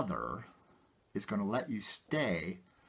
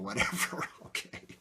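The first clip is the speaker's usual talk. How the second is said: ordinary talk, nothing marked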